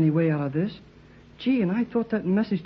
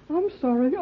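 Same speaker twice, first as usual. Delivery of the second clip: higher, giggling "sorry"; laughing apology; 13 semitones higher than usual. Acted. Boy apologizing to Mrs Gregory while still laughing. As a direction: apologize but can't stop laughing. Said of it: "Why should he laugh when he says he is sorry?".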